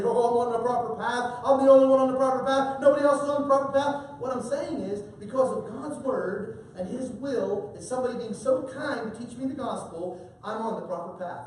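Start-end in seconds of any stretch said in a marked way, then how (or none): 0.0-4.2 hammed-up smug, louder and fast boast; mock the arrogant know-it-all, quick and loud. Preacher mocking an arrogant believer, fast smug boast. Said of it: "I don't do this arrogantly". 10.4-11.5 quiet, humble statement. Shown as a demonstration. Preacher contrasting humble claim with arrogant boast, quiet. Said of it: "I say that very humbly".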